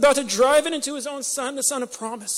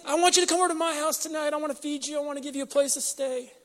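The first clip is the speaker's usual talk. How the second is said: lightly voiced, higher, hopeful widow musing to herself; widow's hopeful inner voice; 6 semitones higher, faster than usual. Preacher voicing the widow's hopeful thoughts, lightly raised pitch. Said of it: "She was probably thinking to herself".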